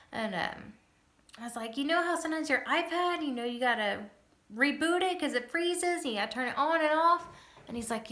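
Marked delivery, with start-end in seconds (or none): 1.8-7.3 put-on explaining to a child. Explaining seizure to six-year-old.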